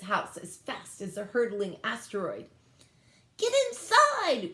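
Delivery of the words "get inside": very high, louder order; squeaky brother hurrying them in; urgent alien. A narrator's reading. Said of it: "cried Nick Lewitzka".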